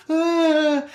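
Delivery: high, mock crying wail from a scared kid; a hammed-up childish cry; imitating a five-year-old bursting into tears; kid's wail. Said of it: "he's crying".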